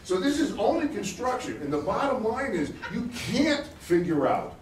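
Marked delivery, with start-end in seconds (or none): none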